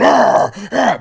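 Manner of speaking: high loud straining arr grunts. Retold or imitated. He-Man doctor pulling at the stuck panel, loud straining grunts. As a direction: heave against a panel that won't give. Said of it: "the He-Man doctor's going".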